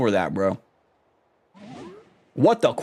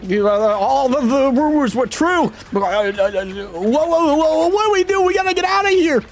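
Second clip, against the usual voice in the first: much higher, hammed-up frantic merchant; panicked merchant voice; 11 semitones higher than usual. Merchant panicking at pirate goats, high squeal. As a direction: squeal it in mock terror. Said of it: "I gotta get a voice for this".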